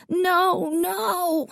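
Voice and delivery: put-on a little boy wailing in despair; child's wail. A narrator's reading. Davy wailing at Dad, very high. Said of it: "Davy wailed".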